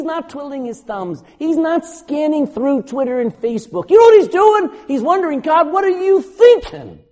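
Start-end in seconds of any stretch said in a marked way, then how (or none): 5.4-7.0 crying out much higher and louder to God; anguished plea. Retold or imitated. Imagined Job on the ash heap crying out to God, anguished. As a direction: beg God for an answer, desperate. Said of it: "Can you imagine him saying that?".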